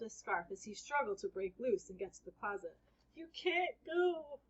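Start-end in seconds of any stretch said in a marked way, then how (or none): 3.2-4.4 high, slow plea; pleading. Acted. Boy begging Harry not to go, high and slow. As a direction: beg him to stay, drawn out.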